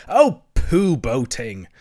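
slow, loud, scornful Toad; great disgust. A narrator's reading. Toad cutting off Rat about boating, disgusted. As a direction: draw it out in disgust. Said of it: "interrupted the toad in great disgust".

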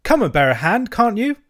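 loud, high call for help; calling out impatiently. Acted. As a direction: shout over, rising in exasperation. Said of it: "they cried".

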